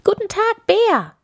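very high, squeaky little hare voice; cheeky hare. A narrator's reading. Cheeky hare greets the grumpy bear, very high-pitched. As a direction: pipe it up loud and high as a hare. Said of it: "der freche Hase sagte".

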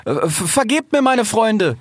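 high, stammering fox; nervous calling down. A narrator's reading. Fox begs pardon from the tree, high nervous stammer. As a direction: call out pleadingly, tripping on the first word. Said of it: "rief Rix den Baum hinab".

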